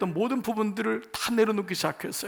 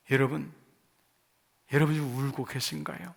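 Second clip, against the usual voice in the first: tender question; 5 semitones lower, 5 dB quieter than usual. Real, not acted. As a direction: lower and softer, asking the congregation gently. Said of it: "여러분에게 한번 여쭤보고 싶어요". (낮고 부드럽게 묻는 목소리)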